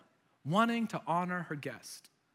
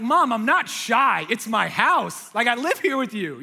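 exasperated protest; 3 semitones higher, 7 dB louder than usual. Acted. Grown child protesting to mom at the table, exasperated. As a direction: protest loudly to an overbearing mother. Acted protest to insistent mother.

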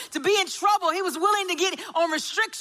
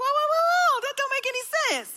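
shocked, much higher, rushing; high alarmed whoa; 11 semitones higher, faster than usual. Retold or imitated. Mother hearing son disobeyed, alarmed high disbelief. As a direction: disbelief pouring out high and fast.

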